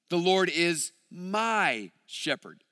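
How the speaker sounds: MY held out for emphasis; stress on MY. Shown as a demonstration. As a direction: stretch "my" and set it apart before "shepherd". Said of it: "putting emphasis on different words".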